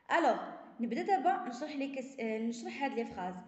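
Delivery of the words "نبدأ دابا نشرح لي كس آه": ordinary delivery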